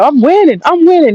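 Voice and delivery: high, mocking "I'm winning" boast; mock the rival's hollow brag. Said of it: "he was coping so bad".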